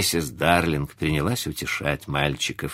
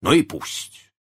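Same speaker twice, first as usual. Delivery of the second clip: raised-pitch, defiant retort; resolute; 6 semitones higher, slower than usual. A narrator's reading. Husband to his hushing wife, resolutely defiant. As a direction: dismiss the worry firmly. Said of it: "отвечал он решительно".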